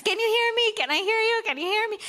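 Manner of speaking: mock shouting can you hear me; shouting into the phone. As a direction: yell down a bad international line. Shouting down a phone-card call.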